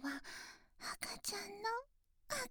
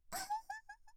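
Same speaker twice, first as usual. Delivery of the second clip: high giggle; 6 dB quieter than usual. Acted. Teasing laugh in roleplay.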